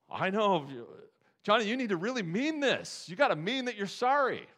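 high, loud, hammed-up exasperated mom; a mock parent insisting the kid mean it. Parent demanding Johnny mean his sorry: high, loud, exasperated. Parent forcing sincere apology.

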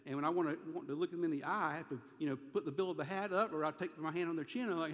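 normal talking voice

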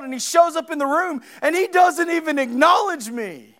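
loud, high-pitched sermon delivery; full-force preaching. Acted. Preacher telling church of Peter's condemnation, full-force preaching. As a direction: preach it at a shout, driving hard.

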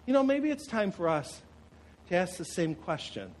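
ordinary talk, nothing marked

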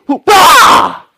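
high, very loud comic burst; hammed-up explosive exclamation; exaggerated outburst. Friend finally looks at the serpent, exaggerated shout. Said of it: "I want to really exaggerate that".

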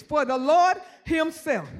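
slow, deliberate scripture reading. Shown as a demonstration. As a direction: drag it out, word by word. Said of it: "I gotta read it slow".

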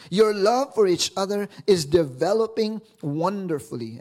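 lifted, earnest reading; expressive paraphrase reading; scripture reading. Acted. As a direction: read it warmly to the congregation. Reads the Message paraphrase.

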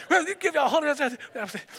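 high, playful mock take on the movie line; mock slap line. Pastor acting out the movie line, playful high mock. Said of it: "Let me give it a try".

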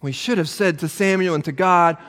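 usual speaking voice

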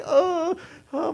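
high, wailing "oh"; mock frightened moan. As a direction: let out a high pretend whimper. Acts out terror in the harness.

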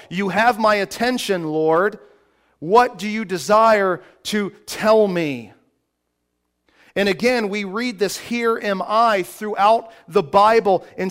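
loud, high, driving preacher voice; full-force preaching. Acted. Pastor preaching to his congregation, fervent full-force preaching.

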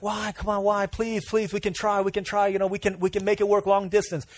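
lightly voiced pleading girl; begging girlfriend. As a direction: plead with him not to leave.

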